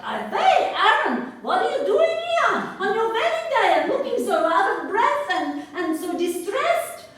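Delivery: high, loud startled exclamation at the door; Ruby surprised to find the groom there, voice jumping up; old Ruby startled at the door, high and loud. Retold or imitated. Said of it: "she's like".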